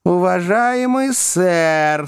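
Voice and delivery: high, slow polite address; fussy little lawyer's discreet tone; confidential little man. A narrator's reading. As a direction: draw him aside with a drawn-out appeal. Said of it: "сказал вполголоса маленький человечек".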